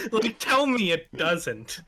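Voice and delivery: words through a laugh; laughing while talking. Real, not acted. Laughing at the email.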